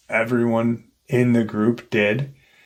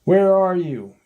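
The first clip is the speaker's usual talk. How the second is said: retold calling out; 8 semitones higher than usual. Searchers call for their missing friend, retold calling out. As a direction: imitate calling out for someone missing. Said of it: "They're yelling out".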